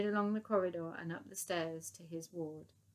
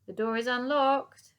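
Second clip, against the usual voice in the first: loud, higher call; 5 semitones higher, 8 dB louder than usual. Acted. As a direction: call out through the door. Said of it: "Dotty called out".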